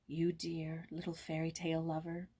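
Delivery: soft, low, loving murmur; tender. A narrator's reading. Cousin Kate embracing Joyce, tender and soft. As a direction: hold her close and say it gently. Kate kisses her softly.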